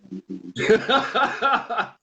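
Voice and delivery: high, loud cackling laugh; burst of laughter. Real, not acted. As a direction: crack up at your own story. Laughing at own caller quote.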